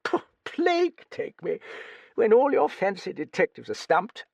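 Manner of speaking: put-on high, crusty old British lady voice; old Duchess. A narrator's reading. Old Duchess quizzing the young writer, high crusty voice. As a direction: play a brusque, nosy old dowager.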